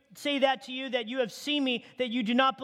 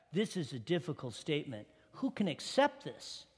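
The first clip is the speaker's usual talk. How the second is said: low, much softer murmur among followers; hushed murmur; 8 semitones lower, 7 dB quieter than usual. Acted. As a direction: mutter it to the man beside you. Disciples murmuring among themselves.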